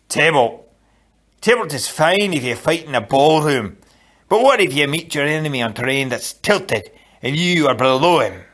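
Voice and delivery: emphatic Scottish fencing master; scoffing Scottish accent. A narrator's reading. Macpherson scoffing at Inigo's training, Scottish accent. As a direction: scoff it in a Scots brogue. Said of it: "the only Scot who ever understood swords".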